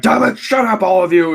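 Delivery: high, loud barking Vince McMahon voice; Vince impression. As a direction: snap it out as a furious boss mogul. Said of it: "that's my Vince impression".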